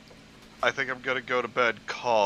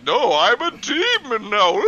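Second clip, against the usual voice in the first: mock demon voice; 11 semitones higher, 8 dB louder than usual. Mocking dramatic fanfic line.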